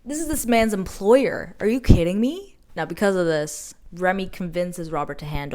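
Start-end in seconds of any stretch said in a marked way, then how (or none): 0.0-2.6 louder, higher outraged disbelief; can't believe the betrayal; indignant disbelief. Real, not acted. Reacting to the employer reveal.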